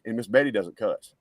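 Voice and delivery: ordinary delivery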